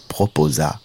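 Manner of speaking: ordinary delivery, nothing marked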